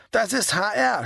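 narrator's restrained shout; mild shout. A narrator's reading. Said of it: "schrie sie in sein Ohr".

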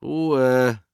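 commanding yell; called-out command. A narrator's reading. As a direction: shout to silence the chatter. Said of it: "rief der Mann".

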